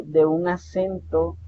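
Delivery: ordinary talk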